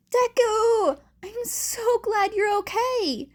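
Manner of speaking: loud, high glad greeting; relieved friend. Acted. Uraraka loudly welcoming Deku. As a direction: burst out with relief.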